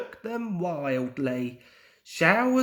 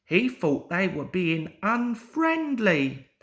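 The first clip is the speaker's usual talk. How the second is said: usual speaking voice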